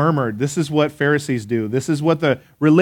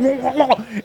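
high-pitched mock gurgle; imitated choking; 7 semitones higher, 3 dB quieter than usual. Choking husband spits up water, imitated gurgling choke. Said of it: "water's coming out".

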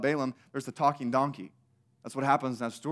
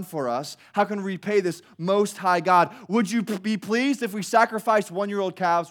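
loud, high mock flattery toward God; snarky Israel; 3 semitones higher, 4 dB louder than usual. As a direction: gush with fake awe, dripping sarcasm. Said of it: "They're being snarky".

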